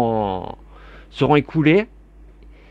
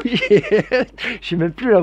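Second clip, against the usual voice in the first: high, very slow, through laughter; giggling, overwhelmed with joy; laughing; 9 semitones higher, much slower than usual. Real, not acted. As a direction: laugh in happy disbelief. Overjoyed, laughing about subscribers.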